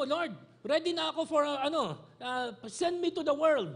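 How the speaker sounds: put-on high, eager child pleading with God. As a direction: plead like an impatient little kid. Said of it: "Yung parang bata, na sinasabi mo".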